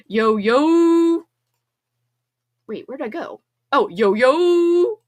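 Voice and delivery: slow, loud, drawn-out yo yo; loud slow hype call; hype greeting. Real, not acted. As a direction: call out to the chat. Greeting new viewers.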